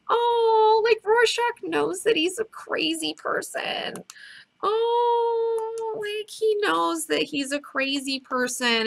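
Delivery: very high, slow, drawn-out aww; touched cooing. Real, not acted. Host feels for Rorschach knowing he's a crazy person, touched cooing. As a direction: coo in sympathy, long high vowels.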